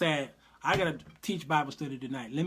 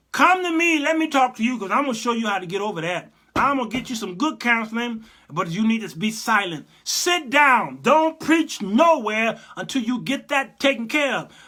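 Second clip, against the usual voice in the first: stern scolding; 6 semitones higher, 7 dB louder than usual. Retold or imitated. Preacher voicing a stern scolding.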